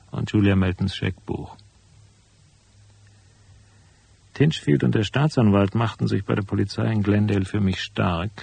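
lauter, nüchterner Erzählton zum Schluss; Erzählstimme. A narrator's reading. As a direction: berichte das Ende wie ein Erzähler. Erzähler-Epilog nach der Szene. (ruhig erzählender Epilog)

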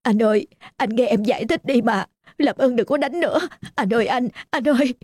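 wife crying, begging her husband to stop beating him; tearful pleading. Acted. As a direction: cry out to stop the fight. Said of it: "Tiếng gào khóc đến xé lòng".

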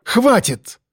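high-pitched exasperated cry; contained shout of enough; shout into phone. A narrator's reading. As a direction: snap at your mother, pitch jumping up. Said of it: "крикнул в трубку".

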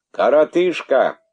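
high, loud shout; king suddenly yells. Acted. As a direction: bark out the name. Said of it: "вдруг крикнул царь".

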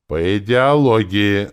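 loud, slow, threatening boom; menacing rumble. A narrator's reading. Druid shouts down Lenora, menacing rumble. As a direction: thunder it out slowly. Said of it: "грозно рокотал Таварин".

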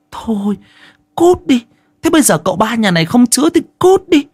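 high-pitched scornful tirade; angry scolding. Acted. Hai Liễu steps up and tells them to get out, scornful scolding.

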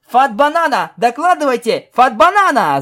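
high, loud radio yell. Acted. Pegasus yelling into her tin can, loud urgent call. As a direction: bellow for a report from your lost comrade. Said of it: "заорала Пегаска в свою банку".

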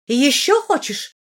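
high, loud challenge; threatening. A narrator's reading. Princess offering the heroine another slap, threatening.

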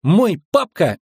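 put-on high-pitched little boy; boyish squeak. A narrator's reading. Boy suddenly piping up about father, squeaky. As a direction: pipe up in a child's squeak. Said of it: "неожиданно пропищал Эверетт".

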